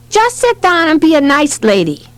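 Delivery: high, lightly voiced bossy kid; bossy little boy. A narrator's reading. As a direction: order mother about like a small child. Bossy toddler orders his mother.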